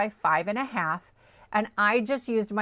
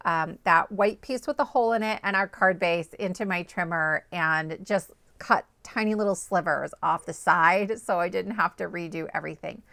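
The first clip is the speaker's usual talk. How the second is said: ordinary delivery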